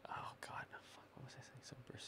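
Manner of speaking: unvoiced, near-silent whisper. Real, not acted. Forgot what he was saying, whispering to himself. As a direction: breathe it to yourself, lost. Losing his train of thought.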